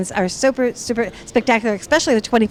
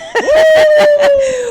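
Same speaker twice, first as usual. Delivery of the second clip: high, loud delighted laugh; excited whooping laugh; 5 dB louder than usual. Real, not acted. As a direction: shriek of laughter at a friend. Greeting Brian on arrival.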